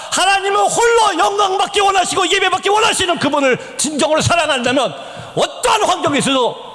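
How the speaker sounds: high, driving preacher's voice; impassioned preaching. Real, not acted. Preacher urging worship in any circumstances, impassioned. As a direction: preach it forcefully, stretching key words.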